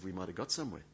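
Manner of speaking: usual speaking voice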